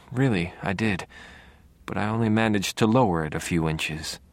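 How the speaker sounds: usual speaking voice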